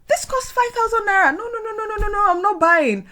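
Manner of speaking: high and loud refusal; mock shocked shopper. Retelling her early shopping, a high mock refusal. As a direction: act the horrified shopper refusing. Imitating her old currency conversions.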